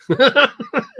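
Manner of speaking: loud high burst of laughter; big loud cackle; laughing. Acted. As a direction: laugh it out loudly. Father's imagined laugh.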